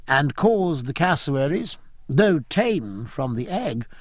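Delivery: ordinary delivery, nothing marked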